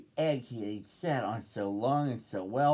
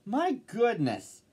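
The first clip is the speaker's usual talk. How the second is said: high, slow exclamations of wonder; astonished crowd, high-pitched and drawn out; amazed shouting; 9 semitones higher, slower than usual. A narrator's reading. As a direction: draw out each cry in amazement. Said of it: "they shouted".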